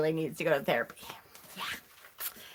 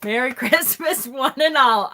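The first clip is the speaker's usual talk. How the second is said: louder, laughing mock-jolly proclamation; ironic merry greeting; 4 semitones higher, 4 dB louder than usual. Host venting to viewers about mother, ironic merry greeting. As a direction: proclaim it with sarcastic festive cheer.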